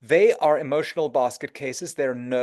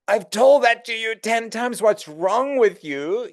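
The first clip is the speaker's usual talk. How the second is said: higher, louder, with the second half slowed; exasperated outburst; 8 semitones higher, 5 dB louder, slower than usual. Shown as a demonstration. Agent losing patience with a client who keeps asking, exasperated. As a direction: snap at the client in frustration. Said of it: "instead of going".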